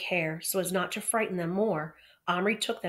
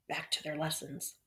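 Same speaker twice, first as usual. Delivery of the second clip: put-on faint old-man mumble, much softer; mumbled; 3 semitones lower, 10 dB quieter than usual. A narrator's reading. Headmaster mumbling as he leaves the class, faint mumble. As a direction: let the words sink to a mumble. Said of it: "His voice dropped to a mumble".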